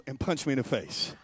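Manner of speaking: ordinary delivery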